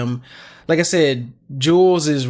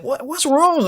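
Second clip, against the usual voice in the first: put-on higher tender question to a crying baby; soothing baby; 11 semitones higher than usual. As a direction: coo to calm her. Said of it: "I'm just like".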